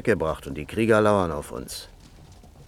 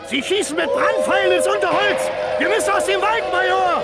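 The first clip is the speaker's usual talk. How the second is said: high, loud battle yell; warning shout; 4 dB louder than usual. Acted. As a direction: yell a warning at the major across the fight.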